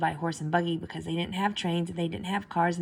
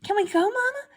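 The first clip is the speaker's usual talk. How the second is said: much higher eager begging; pleading stepsister; 5 dB louder than usual. A narrator's reading. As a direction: beg Mama in a high girlish voice. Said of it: "said one stepsister".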